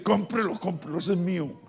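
soft urgent aside; hushed nudge. Retold or imitated. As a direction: whisper it to your husband. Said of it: "su esposa lo codea y le dice".

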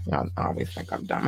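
whispered teasing aside. Real, not acted. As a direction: slip it in under your breath to the listeners. Aside to listeners mid-list.

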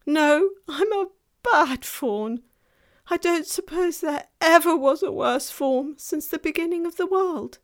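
high, loud, wailing self-reproach; distraught faun. A narrator's reading. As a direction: lament loudly as a guilt-stricken faun. Narrator voices the distraught faun.

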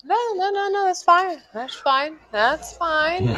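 high, careful, soothing reassurance; walking on eggshells. Real, not acted. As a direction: tiptoe around him gently. Reassuring the apologising co-host.

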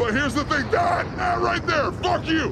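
loud, high shout, slowed on that right there; half-joking furious shout at the skier; mock outrage. Real, not acted. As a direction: yell at the screen in fake fury. Ranting at skier in video.

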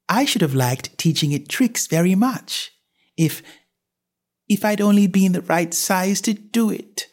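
put-on high, puzzled girlish voice; Alice thinking aloud, worried; Alice fretting. A narrator's reading. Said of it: "said Alice".

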